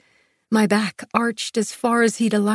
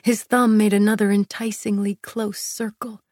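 ordinary delivery, nothing marked

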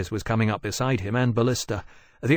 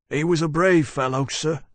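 higher-voiced, tentative old sailor; venturing old sailor; 5 semitones higher than usual. A narrator's reading. Old sailor consoling the captain, venturing. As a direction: venture condolences in a raised character voice. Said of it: "Janus ventured".

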